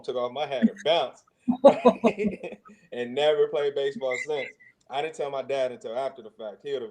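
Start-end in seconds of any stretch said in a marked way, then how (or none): 1.5-2.5 high loud burst of laughter; real loud laugh. Real, not acted. Laughs after bouncing.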